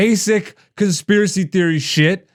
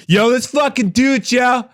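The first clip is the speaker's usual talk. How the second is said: hype call; 6 semitones higher than usual. Real, not acted. Hyping the guest's entrance.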